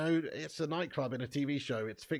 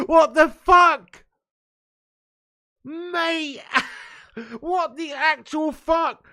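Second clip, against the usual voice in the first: screaming with disbelieving laughter, very high and loud; shocked laughing shout; 13 semitones higher, 11 dB louder, much slower than usual. Real, not acted. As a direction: howl it out, can't believe what you just saw. Shocked at linesman elbow clip.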